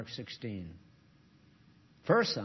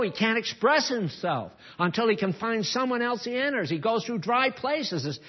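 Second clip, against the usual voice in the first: 1 rising sermon; 6 semitones higher, 4 dB louder than usual. Real, not acted. Preaching at full force.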